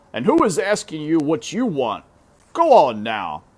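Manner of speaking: put-on father dismissing his begging child; exasperated father. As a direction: scold her off.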